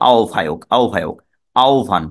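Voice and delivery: আহ্বায়ক as ao-vhayok, আহ্বান as ao-vhan; no h, falling, ao-vhayok, ao-vhan; correct pronunciation. Shown as a demonstration. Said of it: "তাহলে শুদ্ধ উচ্চারণ কি".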